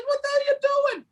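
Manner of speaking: squeaky shocked scolding; wife catching him trading again; high mock wife yelling.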